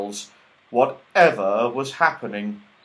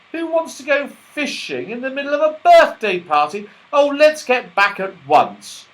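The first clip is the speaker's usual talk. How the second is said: disgusted; 13 semitones higher, 7 dB louder than usual. Acted. Joe disgusted, impatient.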